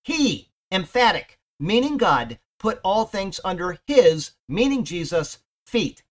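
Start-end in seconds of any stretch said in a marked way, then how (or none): 0.0-0.5 He punched louder and higher, then a pause; stress on He. Shown as a demonstration. Bible teacher shows listeners which word is emphatic, with a punched He. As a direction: hit the word He hard so it stands alone. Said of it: "the he is emphatic".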